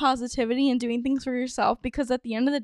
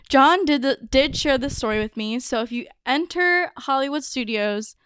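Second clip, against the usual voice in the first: ordinary delivery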